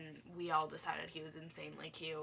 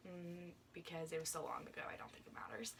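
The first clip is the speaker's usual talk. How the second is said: hushed conspiratorial whisper; whispered aside; 7 dB quieter than usual. Real, not acted. Vlogger whispering to viewers that names hardly matter now. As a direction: lean in and whisper it like a secret. Aside about naming the boy.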